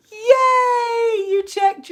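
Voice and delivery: high, loud squeal of delight; excited cheer. Real, not acted. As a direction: cheer for them. Cheering a viewer in chat.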